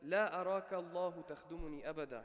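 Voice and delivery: rapid quoted Arabic; Arabic quote read fast. Retold or imitated. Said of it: "And then he said, Ibn al-Mubarak".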